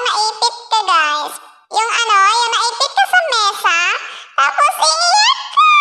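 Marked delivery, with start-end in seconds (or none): 1.7-5.7 put-on high baby voice filter, laughing and whimpering; squeaky baby voice, pinched and about to cry; laughing through a baby voice changer; baby voice effect. Shown as a demonstration. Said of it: "Baby pa ng boses nito".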